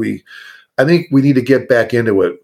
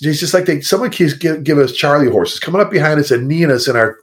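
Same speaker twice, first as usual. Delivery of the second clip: ordinary delivery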